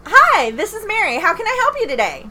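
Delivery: over-bright customer service greeting. Shown as a demonstration. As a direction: fake chipper, high, loud phone greeting. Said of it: "service voice is".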